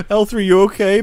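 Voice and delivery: loud, high, hammed-up worry; mock concerned call. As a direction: call out to a fallen friend, overplayed. Acts Lando picking her up.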